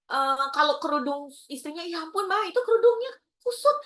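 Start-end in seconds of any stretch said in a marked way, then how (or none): 1.9-3.8 fussing husband. Retold or imitated. Husband fussing over wife's scarf.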